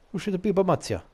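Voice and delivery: Kalzal. Acted. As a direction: lift the voice high, falling at the end. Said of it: "it's Kalzal, not Matsuya".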